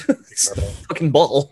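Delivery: laughing, slowed and emphatic. Real, not acted. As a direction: laugh through it in frustration. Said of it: "you know what I'm talking about".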